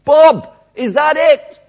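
high, loud, drawn-out shout; distant shout. Retold or imitated. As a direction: call out across an empty stadium. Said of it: "one voice shouted from the far end".

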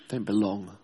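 low and soft, almost to itself; quiet, hollow. Retold or imitated. An outsider telling himself he's excluded, low and quiet. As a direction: murmur it like a lonely admission. Doubter's inner voice.

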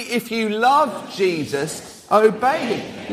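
slow, measured words said together; stretched-out delivery for all to join; group recitation. Shown as a demonstration. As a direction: lead the room in reciting it. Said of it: "Shall we say that word again".

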